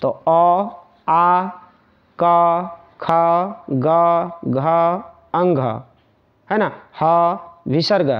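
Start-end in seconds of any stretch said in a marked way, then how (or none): 0.1-5.6 each letter अ आ क ख ग घ said slowly and separately; slow throat-letter demo. Shown as a demonstration. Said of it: "देखो कि ये कहीं ना कहीं कहाँ पे दबाव पड़ रहा है".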